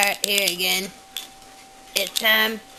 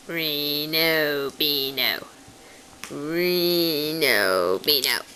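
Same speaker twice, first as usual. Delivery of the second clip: slow, drawn-out, lower-pitched coo; cooing pet name; 4 semitones lower, much slower than usual. Real, not acted. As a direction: coo the cat's name, stretching each word.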